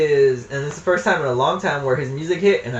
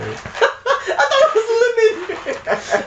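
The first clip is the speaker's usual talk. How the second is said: laughing; much slower than usual. Real, not acted. Laughing fit over candy.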